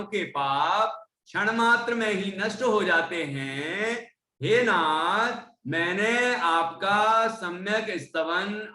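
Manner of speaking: slow, earnest reading of the prayer; recitation of the verse meaning. Acted. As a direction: read it like a devout address to the Lord. Reads verse meaning aloud.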